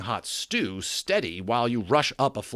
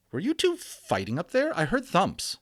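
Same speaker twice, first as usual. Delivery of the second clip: mother's voice; 9 semitones higher than usual. Acted. Mother asks about fighting.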